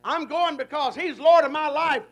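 loud, rising preacher's vow; preaching at full force. Acted. Preacher voicing a believer's vow to the congregation, at full force. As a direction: build it up like a Sunday sermon climax.

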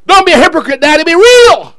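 high, forceful shout; preacher's loud exhortation. Real, not acted. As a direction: drive it home to the fathers, loudly.

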